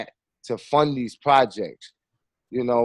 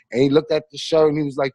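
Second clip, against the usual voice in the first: ordinary delivery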